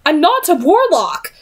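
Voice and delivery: sharp, high indignant denial; a narrator's angry young woman; angry protest. A narrator's reading. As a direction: push back hard at the accusation. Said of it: "Tessa protested angrily".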